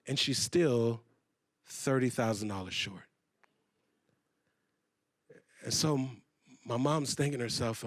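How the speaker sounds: hushed, low storytelling; sombre. A narrator's reading. Speaker telling mother is thirty thousand short, sombre hush.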